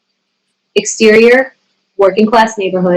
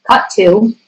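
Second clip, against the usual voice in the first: usual speaking voice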